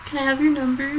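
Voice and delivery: high, soft timid voice; mock shy ask. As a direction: ask meekly like a nervous guy. Imitating shy coworker.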